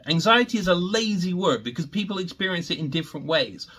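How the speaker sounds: ordinary talk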